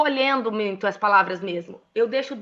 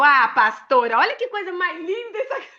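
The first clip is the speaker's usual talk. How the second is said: cheerful praise; 6 semitones higher than usual. Retold or imitated. Cheerful to a crying pastor.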